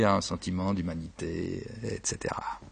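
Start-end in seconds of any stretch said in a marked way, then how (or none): none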